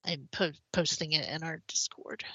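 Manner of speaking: soft, voice giving out; losing voice. Real, not acted. Host telling co-host about a Discord post, losing voice. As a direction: talk quietly as the voice fades away. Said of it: "sound like I'm losing my voice".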